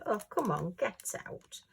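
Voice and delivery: ordinary talk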